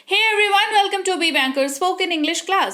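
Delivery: cheerful channel opening; upbeat intro. Acted. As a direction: greet the viewers like a show host.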